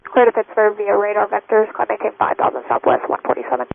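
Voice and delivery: thin radio transmission from the cockpit; pilot cockpit headset. Real, not acted. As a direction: headset mic over the radio. Pilot reads back clearance.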